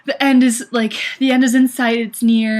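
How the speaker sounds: high, loud burst of hope; hopeful excitement. Acted. Runner voicing her ray of hope as sun appears, excited. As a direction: call it out as if spotting the finish.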